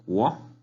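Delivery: "Guo" gliding upward; rising tone. Shown as a demonstration. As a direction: sweep the pitch up through "Guo". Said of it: "el tono hacia arriba".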